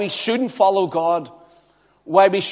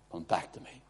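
hushed echo; 12 semitones lower, 18 dB quieter, faster than usual. Shown as a demonstration. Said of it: "and come back to me".

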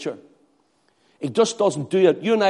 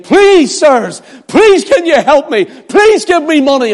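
much higher, loud pleading cry; desperate beggar; 9 semitones higher, 6 dB louder than usual. Acted. Lame man at the gate begging Peter and John, loud high pleading. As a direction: cry out for alms like a starving beggar. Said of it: "And he was saying".

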